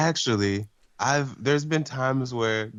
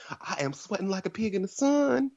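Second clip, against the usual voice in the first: put-on Southern accent; 10 semitones higher than usual. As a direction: play a flustered Southern lady, pitched way up. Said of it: "She has a really southern accent".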